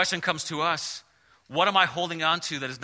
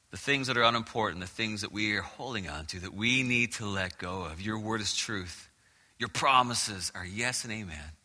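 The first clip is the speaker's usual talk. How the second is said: closing prayer; 6 semitones lower than usual. Real, not acted. Pastor praying over the congregation, low and hushed.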